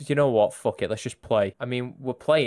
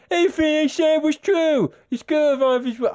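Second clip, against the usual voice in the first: high, silly schoolkid voice; put-on game character voice; 9 semitones higher, 3 dB louder than usual. Schoolkids arguing about going back, high put-on voice. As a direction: pitch it right up, hammed-up. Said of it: "I'm gonna stop doing that".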